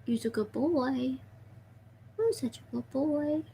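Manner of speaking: very soft, cooing praise. Real, not acted. Owner praising their pet snake, soft baby talk. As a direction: coo quietly to your pet, pitch lifting.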